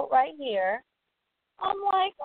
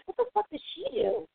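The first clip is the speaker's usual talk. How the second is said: retold disbelief; 10 semitones higher, 3 dB quieter, faster than usual. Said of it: "And I was like".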